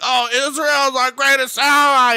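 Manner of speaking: high-pitched mocking quote; mock Ted Cruz. Speaker mocks a senator praising the greatest ally, high and gushing. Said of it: "Ted Cruz that was just basically saying".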